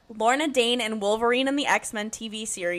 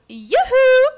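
much higher, louder sing-song call; cheery yoo-hoo call; 12 semitones higher, 7 dB louder than usual. Retold or imitated. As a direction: call out brightly like a shopkeeper. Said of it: "she goes".

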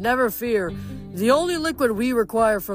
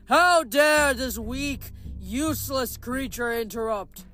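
slow, scornful, higher giant king; contemptuous outrage; 7 semitones higher, slower than usual. A narrator's reading. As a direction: spit out the contempt for the weak girl. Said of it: "Porphyrion thundered".

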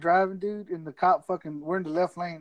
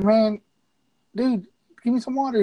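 high, loud pleading call; loud plea; 6 semitones higher, 6 dB louder than usual. Retold or imitated. Begging the cop.

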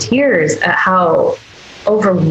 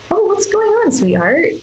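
tender soothing voice; 12 semitones higher than usual. Shown as a demonstration. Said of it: "this poor body never gets that from us".

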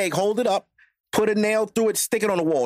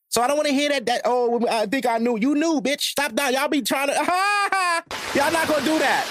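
high, worked-up rant; heated rant; 10 semitones higher than usual. Real, not acted. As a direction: rant at them, voice climbing. Ranting at women, pitch up.

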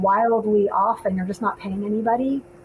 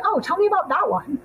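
pitched way up, light and eager; high mock voice; 9 semitones higher than usual. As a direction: squeak it out like an eager asker. Quoting another person.

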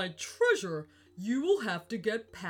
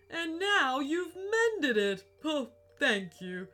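choked-up, grateful ghost; tearful; 5 semitones higher, 4 dB louder than usual. Acted. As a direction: thank them close to tears. Viking moved to tears.

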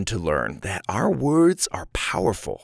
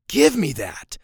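higher, curt grabby order; rude demand; 6 semitones higher, slower than usual. Shown as a demonstration. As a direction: demand it without any please. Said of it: "that's a really rude way".

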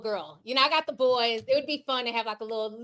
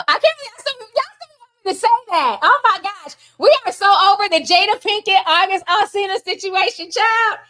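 exasperated; 7 semitones higher, 9 dB louder than usual. Real, not acted. Exasperated after the catchphrase request.

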